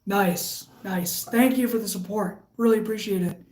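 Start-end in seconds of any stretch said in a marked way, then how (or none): none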